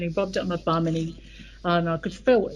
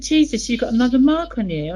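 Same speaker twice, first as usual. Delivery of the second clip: retold shock, higher and louder; shocked husband; 5 semitones higher, 7 dB louder than usual. Husband finds a new mark on his wife, shocked. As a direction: exclaim it as the startled husband.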